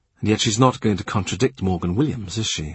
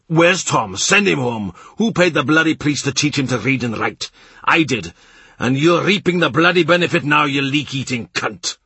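higher, louder furious rant; angry rant; 6 semitones higher, 4 dB louder than usual. A narrator's reading. Morgan ranting at Kat about Tom, angry and loud. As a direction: rage at the boy, loud and high.

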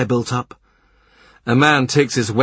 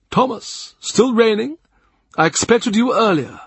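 higher, affable churchman's voice; the Cardinal's voice; 13 semitones higher than usual. A narrator's reading. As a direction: greet him like an indulgent prince of the church. The Cardinal voiced higher, breathy start.

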